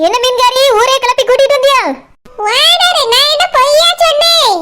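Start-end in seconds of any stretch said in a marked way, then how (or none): 2.5-4.6 high, drawn-out righteous fish seller voice; fish seller, slow and proud. Acted. Fish seller proudly tells the owner she spoke the truth. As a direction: proclaim it slowly, as if to the whole world.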